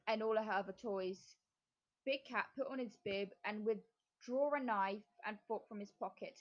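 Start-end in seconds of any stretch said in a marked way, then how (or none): none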